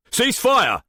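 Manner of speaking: loud barked command; shouting. A narrator's reading. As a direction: yell it across the room. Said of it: "Haken shouted".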